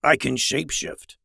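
beastly monster character voice. A narrator's reading. Monster Reed explains himself to brothers, monster voice. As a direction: say it as a big monster. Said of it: "in his monster voice".

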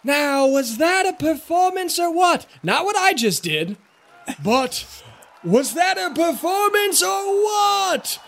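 high, booming showman voice; showman boast. Acted. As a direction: rile up the crowd like a ringmaster. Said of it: "and goes".

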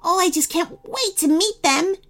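put-on very high eager child; excited. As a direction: bubble over with excitement. Gingerbread boy excited.

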